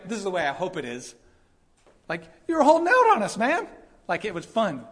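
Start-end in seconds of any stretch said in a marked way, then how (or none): 2.5-3.7 much higher, louder, delighted teasing; thrilled master ribbing the groom; excited. Retold or imitated. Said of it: "Like, he's excited".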